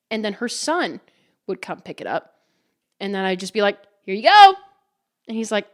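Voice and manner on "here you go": high, loud sing-out to a customer; bright handover call. Retold or imitated. Server handing Samantha's son the order, loud cheery call. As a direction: call it out big as you hand it over.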